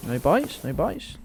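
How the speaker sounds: usual speaking voice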